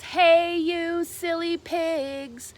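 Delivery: loud, high drawn-out yell at the pigs. A narrator's reading. Megan yells at the pigs, loud and slow. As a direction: shout it out slow at the silly pigs. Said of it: "and yelled".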